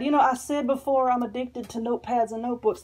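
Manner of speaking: ordinary talk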